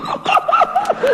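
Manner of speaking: very high imitation of helpless laughter; roaring laughter. DJ listening to the record, imitated roaring laughter. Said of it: "he was roaring".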